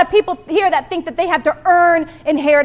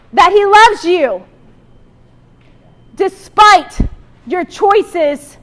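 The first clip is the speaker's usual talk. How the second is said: loud, slow, with long pauses between "That he loves you", "despite" and "your choices"; big preacher emphasis on "despite"; emphatic preaching; 6 dB louder, much slower than usual. Acted. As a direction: land each phrase with a pause, louder on "loves" and "despite". Preacher driving home key point.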